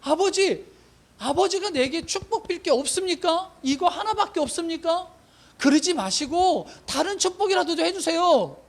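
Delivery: mild acted wailing plea, pitched high. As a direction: beg a father in a high voice, only lightly tearful. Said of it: "손이 높여 대성통곡하는 거예요". (높은 톤으로 울며 매달리는 시늉)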